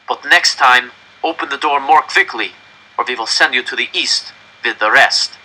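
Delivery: put-on German accent, w said as v; German officer warning. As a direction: warn them with cold menace. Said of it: "the German officer said".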